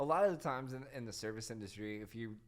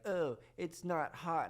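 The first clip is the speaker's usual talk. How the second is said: slow, higher-pitched mock whine; bitching complaint; 6 semitones higher, much slower than usual. Lady complains about her burger, mimicked griping. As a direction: imitate a drawn-out customer gripe. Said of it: "fucking bitching about her fucking burger".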